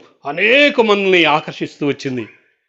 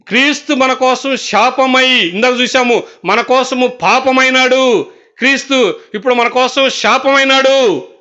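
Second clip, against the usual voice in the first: higher, slow, ringing sermon voice; fervent pulpit preaching; preaching at full force; 6 semitones higher than usual. Acted. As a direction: preach it out over the congregation, each line rising. Preaching climax on the curse.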